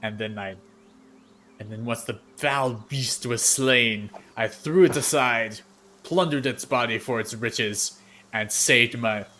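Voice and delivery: loud, slow bragging war story; a swaggering warrior hamming up a bloody tale; boastful warrior. Acted. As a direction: boom it out like a proud fighter reliving a kill. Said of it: "the Earth Genasi in the middle says".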